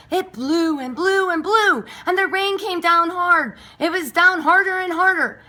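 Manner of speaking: building storm drama. A narrator's reading. Narrating the storm to the listener, dramatic build. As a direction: swell with the storm.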